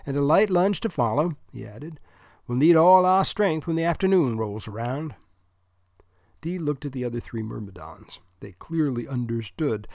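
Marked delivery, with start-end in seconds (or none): 0.1-1.4 louder, higher New England character voice; projected New England speech; New England accent. A narrator's reading. Dude's dialogue, New England voice. 2.4-5.1 louder, higher New England character voice; projected, self-assured New England speech; New England accent. A narrator's reading. Dude's dialogue, New England voice.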